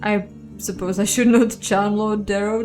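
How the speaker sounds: plain reading voice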